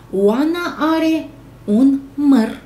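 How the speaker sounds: teacher reads the sentence very slowly; slow model reading. Shown as a demonstration. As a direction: read each word slowly for the class. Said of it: "Încercați și voi să citiți".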